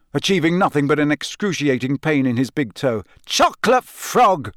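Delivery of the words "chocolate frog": high, slow, frustrated yell of a password; angry yell. A narrator's reading. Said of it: "he yelled angrily".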